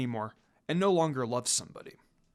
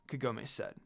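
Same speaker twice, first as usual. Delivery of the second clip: usual speaking voice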